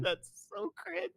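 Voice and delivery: high, slow mock scoff. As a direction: sneer it in a silly high voice. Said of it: "We were like".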